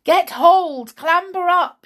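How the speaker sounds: high, loud urgent commands; forceful rescuer's shout. A narrator's reading. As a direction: call instructions to someone in danger. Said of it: "she shouted".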